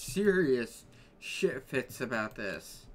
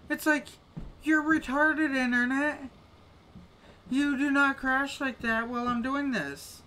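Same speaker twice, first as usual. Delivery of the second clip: much higher, louder scolding of the internet; scolding rant; 11 semitones higher, 3 dB louder than usual. Real, not acted. Streamer scolds his crashing internet, much higher and louder. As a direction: tell the internet off, worked up.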